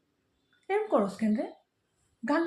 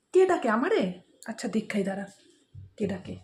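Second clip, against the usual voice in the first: normal talking voice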